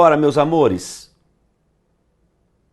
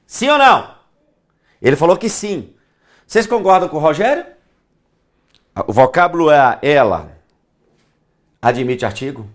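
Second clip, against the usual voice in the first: usual speaking voice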